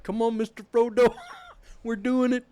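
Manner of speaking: hammed-up eager sidekick cheer; mock Sam. Friend retelling the movie, voicing Sam cheering Frodo on with a laugh. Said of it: "Sam's just there like".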